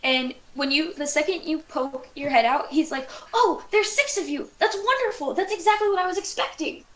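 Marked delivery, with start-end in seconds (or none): 3.4-6.8 high-pitched, delighted little alien; overjoyed alien making a wonderful discovery; excited alien. Acted. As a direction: exclaim with glee, pitched well up. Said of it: "very excited about the fact that he found".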